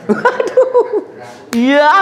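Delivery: high-pitched laughing talk; giggling while apologising to the patient. Real, not acted.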